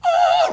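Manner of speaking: very high mock angelic note; heavenly "oh". Friend appears like a beacon of light, mock heavenly high note. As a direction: sing out a high awed "oh". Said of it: "like this beacon of light".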